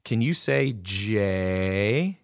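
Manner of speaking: put-on each word drawn out slowly, like coaching a small child; slow coaxing prompt to a little brother; slow, careful prompt. Big brother drills his little brother on letters, slowly and patiently. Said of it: "and I said".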